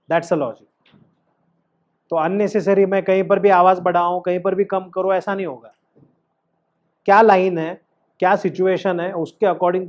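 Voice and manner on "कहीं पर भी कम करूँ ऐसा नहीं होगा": usual speaking voice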